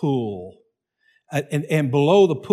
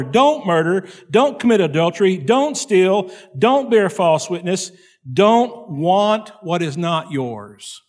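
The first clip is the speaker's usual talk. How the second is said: louder, higher commandment recital; preaching; 4 semitones higher, 4 dB louder than usual. Acted. Preacher recites the commandments to the congregation, proclaiming. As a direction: proclaim each law firmly.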